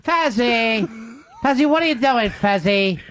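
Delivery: exaggerated, drawn-out pestering call; bad Ron impression. Host mimics Tommy Z's bad impressions, exaggerated calling. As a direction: badly mimic a radio host nagging his partner. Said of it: "they're all like this".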